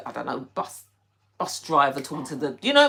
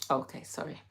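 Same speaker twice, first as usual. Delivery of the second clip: ordinary talk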